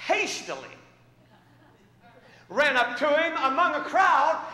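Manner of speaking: loud, high preacher's storytelling; preaching at full force. Acted. Preacher telling the congregation his story, loud. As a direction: drive the story home from the pulpit.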